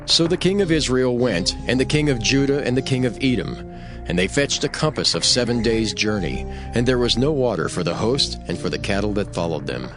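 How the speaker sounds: plain reading voice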